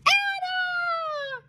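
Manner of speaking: very high, thin held squeal; excited shriek. Acted. Friend answers mom from a foot away, shrieking. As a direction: squeal the name in delighted greeting. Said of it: "shrieked back".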